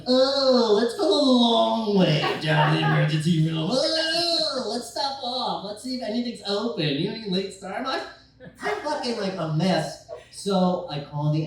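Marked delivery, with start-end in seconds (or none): 0.1-7.8 high, loud, airy mock voice; mocking dawdling driver. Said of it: "I can't have her like".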